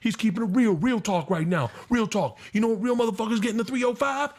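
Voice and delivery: high, fast hyped-up street voice; mock hype man. Comic retelling a rowdy Miami crowd member, mock hyped voice. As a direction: hype it up like a Miami club host.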